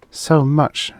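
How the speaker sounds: bitter thought. A narrator's reading. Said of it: "she thought bitterly".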